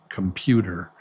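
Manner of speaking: usual speaking voice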